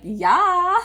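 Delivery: high, loud eager yes; excited yeah. Retold or imitated. Daughter answering dad's install offer, high eager yes. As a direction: jump at the offer. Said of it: "And I'm like".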